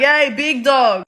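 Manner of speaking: loud, drawn-out friendly holler; hearty greeting. Retold or imitated. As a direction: hail them like a big mate. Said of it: "I meant, like".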